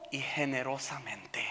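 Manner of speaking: sudden breathy whisper of the last word; whispered wonder at grace. Acted. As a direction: drop to a whisper to let it land. Sermon, whispered climax of a phrase.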